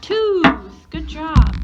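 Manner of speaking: high, loud delighted praise; excited praise. Real, not acted. Teacher praising child's answer.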